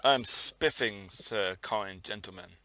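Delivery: put-on slow, soft posh old English gentleman; posh English gent. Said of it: "when did people stop speaking like that".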